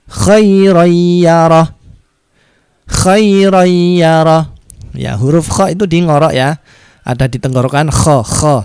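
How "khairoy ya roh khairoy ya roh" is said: slow recited "khairoy ya roh" with a throaty kh and a hummed oy; drawn-out "khairoy ya roh", kh from the throat; nasal merge into ya. Shown as a demonstration. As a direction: chant "khairoy ya roh", gliding the n into y. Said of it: "tapi bacanya".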